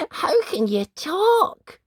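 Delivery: very high, slow, wondering; little girl amazed at scarecrow. Acted. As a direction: marvel at a talking scarecrow. Dot marvels at scarecrow.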